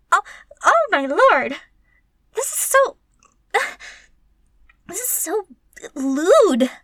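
loud, breathless scandalized exclamation; scandalized shock. Acted. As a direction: blurt it out in startled disbelief. Shocked by a lewd script.

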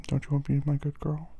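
ordinary talk

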